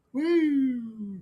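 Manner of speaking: loud high whoop of delight; excited whoop. Real, not acted. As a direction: let out a cheering woo. Cheering a betting combo.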